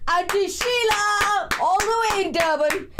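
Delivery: high, drawn-out host introduction; announcer build-up. Real, not acted. Host introducing Auntie Sheila to the audience, announcer build-up. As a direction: stretch the name out high and proud.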